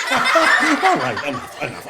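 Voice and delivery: laughing, then settling down; laugh, then rein it in. Real, not acted. Laughing at own joke.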